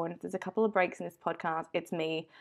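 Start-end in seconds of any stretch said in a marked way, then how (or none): none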